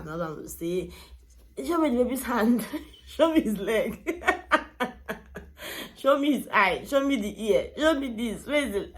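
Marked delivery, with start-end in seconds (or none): 1.6-4.2 excited, laughing mock husband at scans, raised pitch; paranoid husband. Wife imitating her paranoid husband at every scan, higher and louder. 4.3-5.9 breathy high laugh. Real, not acted. Wife laughing at her husband's scan demands, high laugh. 6.0-8.9 excited, laughing mock husband at scans, raised pitch; paranoid husband. Wife imitating her paranoid husband at every scan, higher and louder.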